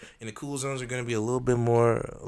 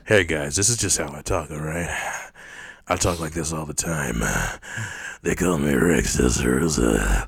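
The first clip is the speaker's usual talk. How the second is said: lowered, louder fake-deep voice; put-on deep voice; 6 semitones lower, 4 dB louder than usual. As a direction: push the voice down to sound deep and sexy. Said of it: "trying to make his voice deeper".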